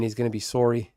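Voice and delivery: "sorry" with a Canadian rounded SORE-ee vowel; Canadian accent. Pretend. Streamer joking about a Canadian opponent, "sorry" in Canadian accent. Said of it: "If he's Canadian".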